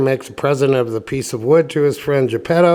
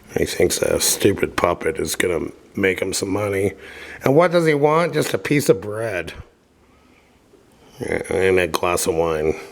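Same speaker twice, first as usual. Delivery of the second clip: plain reading voice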